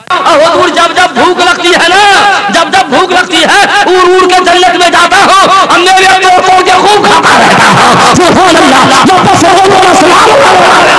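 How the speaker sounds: loud, high, fervent voicing of Jafar's words; preaching at full force. Preacher voicing martyr Jafar to the Prophet, loud and fervent. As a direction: ring it out to the crowd with joy.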